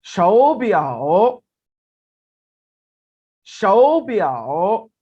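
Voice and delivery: शओ rising as second tone, ब्याओ dipping, slow and loud; third tone sandhi demo. Shown as a demonstration. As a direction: say शओ with a rise before ब्याओ. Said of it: "third tone को मैं second tone में convert करके बोलूंगा". (शओ को second tone में उठाकर, धीरे और ज़ोर से)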